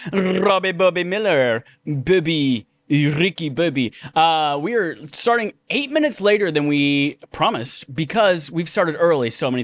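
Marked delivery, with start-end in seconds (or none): 0.1-3.9 Robbie Bobby Miller slow, drawn out, in a put-on voice; playing with the name. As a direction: savour Bobby in a silly put-on accent, slowly. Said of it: "gonna say it a few more times".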